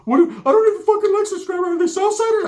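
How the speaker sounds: squeaky high cartoon-mouse cousin; Mickey Mouse voice. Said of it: "put the Mickey Mouse to it".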